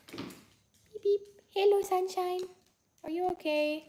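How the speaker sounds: high, sweet pet voice. Real, not acted. Greeting her sleepy dog, high sweet baby talk. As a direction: coo it to a beloved puppy.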